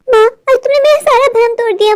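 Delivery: put-on high girlish voice, hurt; a spoiled daughter let down by her mother; whiny daughter. Daughter Gudiya complains.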